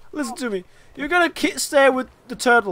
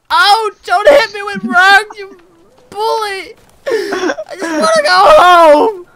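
louder, higher hammed-up sobbing wail; mock crying whine; 8 semitones higher, 6 dB louder than usual. Begging the bully to stop, hammed-up crying wail. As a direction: wail it like a bullied child.

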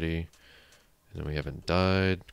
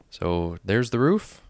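drawn-out, louder showcase of the roof; proud reveal; 4 semitones higher, 8 dB louder, slower than usual. Real, not acted. Showing viewers the new roof, proud loud reveal. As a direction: announce it with a slow rising flourish.

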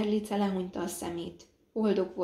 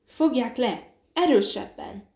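loud, higher urgent command; urgent raised voice; shouted orders; 4 semitones higher, 6 dB louder than usual. A narrator's reading. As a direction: call out orders to hold her down. Urgent orders to restrain her.

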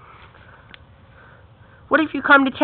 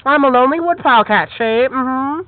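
ordinary talk

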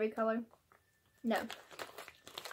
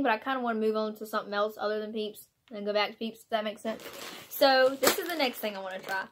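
normal talking voice

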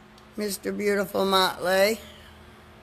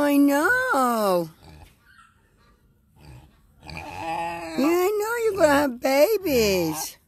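higher, sing-song coo to a pig; cooing pet talk; 6 semitones higher than usual. Real, not acted. Farmer coaxing a pregnant pig with a carrot, high cooing.

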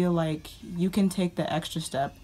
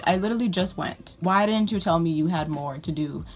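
ordinary talk, nothing marked

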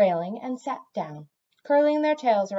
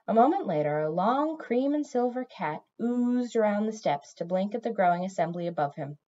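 plain reading voice

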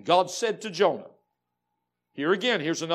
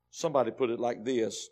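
usual speaking voice

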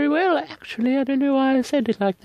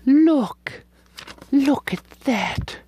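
slow admiring; much slower than usual. Real, not acted. Admiring paper.

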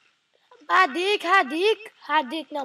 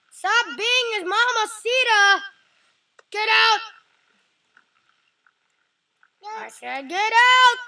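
loud, higher, furious yell; angry yelling; 4 semitones higher, 7 dB louder than usual. Real, not acted. Frustrated gamer yelling at his character, loud and angry. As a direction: bark it at the screen.

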